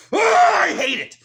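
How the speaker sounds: high, loud, drawn-out cry of hate; frustrated scream. Real, not acted. Fed up with being labelled, screamed outburst. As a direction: scream it out in real exasperation.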